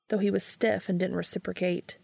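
ordinary delivery, nothing marked

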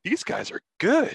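high, drawn-out tipsy admiration; hammered fans. Acted. Drunk fans on the step praise the broadcast, high slurry awe. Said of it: "the people that are hammered".

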